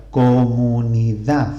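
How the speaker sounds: "comunidad" in beats, landing hard on DAD; co-mu-ni-DAD, "comunidad" with a stretched last syllable; stress on final syllable. Shown as a demonstration. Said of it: "tiene la sílaba tónica al final de la palabra".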